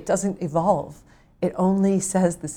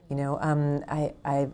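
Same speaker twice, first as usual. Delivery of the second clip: usual speaking voice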